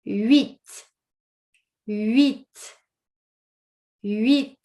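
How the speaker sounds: huit as wit, U then E; huit, H silent. Shown as a demonstration. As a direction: huit with a forced little smile on the I. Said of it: "listen carefully".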